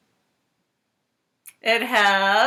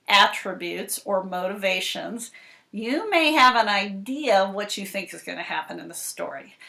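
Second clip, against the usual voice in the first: ordinary delivery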